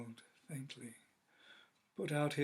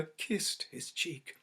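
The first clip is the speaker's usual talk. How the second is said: usual speaking voice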